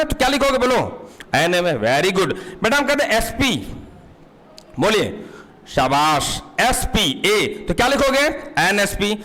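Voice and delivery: ordinary talk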